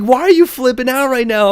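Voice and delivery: asking why she's flipping out; exasperated high question. Retold or imitated. To Riley parking, exasperated high question. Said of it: "I'm like".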